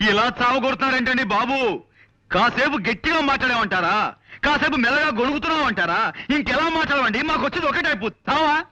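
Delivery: high-pitched exasperated outburst; exasperated yelling. Acted. Visitor asks the old man how he should talk, exasperated. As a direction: plead at the top of your range, fed up. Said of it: "ఎందుకు అలా అరుస్తారు కంఠశోష".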